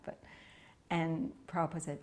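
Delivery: ordinary talk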